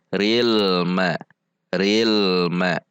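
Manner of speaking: "Ril ma" connected, l lightly flowing, no bounce; joined bainiyah l. Shown as a demonstration. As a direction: flow from "Ril" straight into "ma". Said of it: "tetap disambung dengan sifat dari". ("Ril ma" disambung, lam bainiyah)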